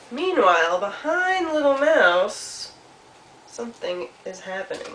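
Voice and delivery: stretched, swelling build, then hushed; suspenseful storytelling. A narrator's reading. Storyteller hints at something behind Mouse, suspenseful.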